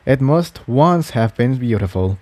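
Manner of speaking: plain reading voice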